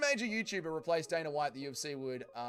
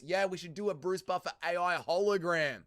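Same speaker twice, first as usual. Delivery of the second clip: ordinary talk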